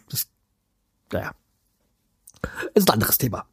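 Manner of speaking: a whisper, waving the topic away; whispering to drop the subject; whispered aside. Real, not acted. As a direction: mutter it under your breath as a whisper.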